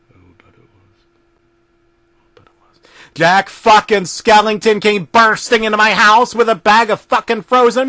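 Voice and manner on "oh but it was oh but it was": breathy dramatic whisper. A narrator's reading. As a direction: whisper it like a horror reveal.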